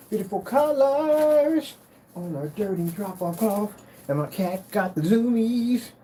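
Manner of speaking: high, slow sing-song pet voice. Real, not acted. Owner cooing at his cat Taco, high and slow.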